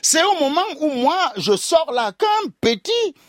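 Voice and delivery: usual speaking voice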